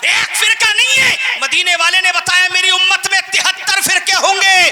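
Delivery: high, driving pulpit oratory; fiery preaching. Real, not acted. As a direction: hammer out the list, then stretch the key phrases. Preaching at full force.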